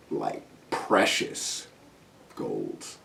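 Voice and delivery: slow, breathy, awed "precious golds"; mocking the saleswoman's fancy "precious golds"; mock reverent whisper. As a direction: whisper "precious golds" slowly like something sacred. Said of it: "Why you say it like that?".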